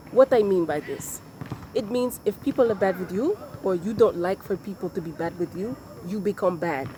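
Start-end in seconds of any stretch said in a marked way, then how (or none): none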